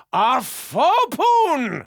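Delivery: slow, stretched, delighted cry; gleeful discovery. Acted. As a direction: savour the word like a prize. Delighted at spork find.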